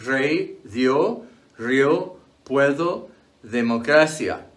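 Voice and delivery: rey, dio, puedo with both vowels glided into one syllable; diphthong as one syllable. Shown as a demonstration. Teacher giving examples like democracia, vowel pair said as a single syllable. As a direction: run the two vowels of dio together as one beat. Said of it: "is pronounced as a single syllable".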